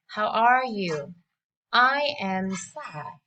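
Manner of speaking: ordinary talk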